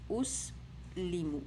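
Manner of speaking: اس لیمو as "us-lee-mu"; oo then ee in اس لیمو; "oo-ee" sounds. Shown as a demonstration. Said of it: "او ای sound".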